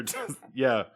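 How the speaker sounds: ordinary talk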